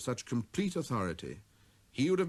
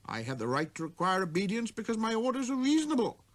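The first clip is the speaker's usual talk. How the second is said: the king; 7 semitones higher than usual. Acted. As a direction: lay down the law with reason. Voices the king.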